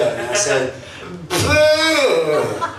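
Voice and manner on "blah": high wordless blurt, falling away; hammed-up failure to form any words; tongue-tied babble. Preacher imitating his answer when asked his name, tongue-tied babble. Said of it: "I can't talk".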